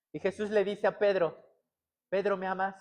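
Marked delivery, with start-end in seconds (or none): 2.1-2.8 soft, tender asking; gentle question. Retold or imitated. Jesus asks Peter if he loves him, gently. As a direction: ask softly, in a low-key voice.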